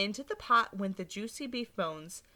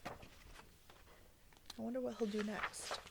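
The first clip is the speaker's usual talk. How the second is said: slow, much softer musing; hushed wonder; 12 dB quieter, much slower than usual. Real, not acted. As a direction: draw them in with a hush. Suspense for children.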